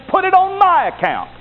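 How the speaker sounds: very high, ringing declaration; impassioned offer. Acted. Jesus taking on the sinner's debt, high impassioned cry. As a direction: cry it out at the climax.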